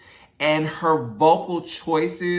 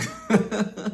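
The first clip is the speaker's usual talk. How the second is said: short laugh; 6 semitones higher than usual. Real, not acted. Laughs over the recap wrap-up.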